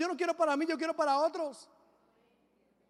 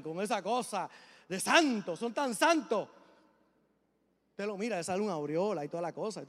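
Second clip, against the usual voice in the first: ordinary talk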